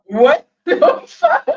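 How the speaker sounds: loud, high, drawn-out and cracking up; real laughing disbelief. Real, not acted. Commentator reacting to mother's excuse, laughing in loud disbelief. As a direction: burst out laughing on the words. Co-host laughs at streamer's admission.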